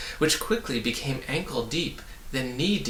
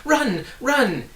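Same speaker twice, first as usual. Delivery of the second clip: much louder, much higher urgent cry; 12 semitones higher, 6 dB louder than usual. A narrator's reading. A hidden voice urging the travellers to flee, crying out. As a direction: yell a warning from afar. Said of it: "it urged once more".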